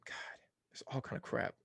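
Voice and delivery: irritated whisper to himself; whispered complaint. Real, not acted. Host whispers his annoyance at popups on his screen. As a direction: hiss it under your breath at the screen.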